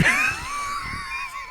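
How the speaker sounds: high wheezing laugh; trailing fit of laughter; helpless laughter. Real, not acted. Player cracking up at the randomizer, helpless laughter.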